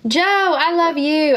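high, bright-eyed greeting to Joe; excited squeal. Real, not acted. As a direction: squeal hello to a friend who just showed up.

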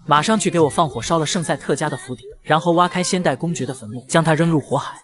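ordinary delivery

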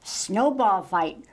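usual speaking voice

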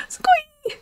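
very high excited squeal; a fan squealing at the sight of a penguin; delighted squeal. Acted. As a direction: squeal with pure joy. Animal thrilled to see a penguin.

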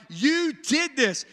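mock angry complaint; upset accusing. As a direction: throw the blame at Moses, a touch heated. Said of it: "they're upset, they're mad".

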